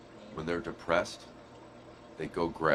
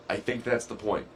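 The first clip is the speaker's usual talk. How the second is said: ordinary delivery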